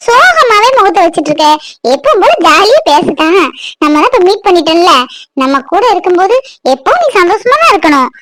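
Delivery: ordinary talk, nothing marked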